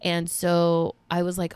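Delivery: usual speaking voice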